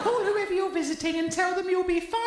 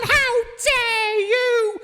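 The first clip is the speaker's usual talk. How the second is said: outraged; 3 semitones higher, much slower than usual. Acted. Mother outraged at accusation.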